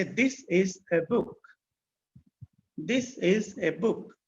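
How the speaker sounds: slow, evenly spaced words; slow dictation. Shown as a demonstration. Teacher giving students an example sentence, slow and deliberate. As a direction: dictate it word by word.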